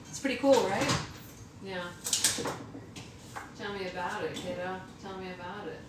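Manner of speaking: soft deadpan mutter; whispered wry aside. Real, not acted.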